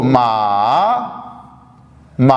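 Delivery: मा dipping low then rising; third tone ma. Shown as a demonstration. Teacher repeating the tones, dipping मा. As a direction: drop मा low, then lift it. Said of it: "पहले नीचे की तरफ आएगी फिर ऊपर की तरफ जाएगी".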